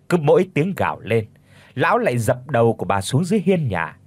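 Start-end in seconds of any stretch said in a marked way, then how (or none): none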